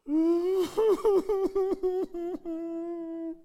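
high, breaking laughter. Real, not acted. Pastor laughing at his own story, high and loud.

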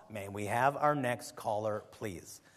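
low, slow, soft radio host sign-off; imitating Camping. Mimicking the radio host moving to the next caller, slow and low. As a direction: mimic the old host's dry call for the next caller. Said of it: "the way he, he said".

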